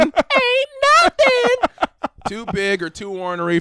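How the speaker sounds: very high, loud, slow holler; hammed-up boast. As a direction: holler the brag, stretching it out. Repeats the character boast louder.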